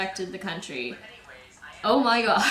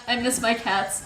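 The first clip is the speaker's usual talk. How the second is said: ordinary delivery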